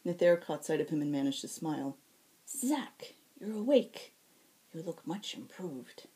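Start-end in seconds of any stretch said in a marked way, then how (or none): 2.5-3.2 weary whisper. Acted. Nether greets Zack. 3.4-6.0 lightly voiced tired healer, gentle; weary healer. Nether's character voice.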